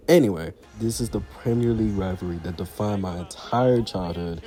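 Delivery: ordinary talk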